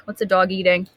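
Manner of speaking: ordinary delivery, nothing marked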